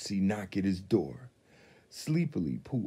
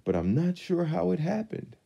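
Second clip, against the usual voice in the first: Pooh voice; 11 semitones higher than usual. A narrator's reading. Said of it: "Pooh said".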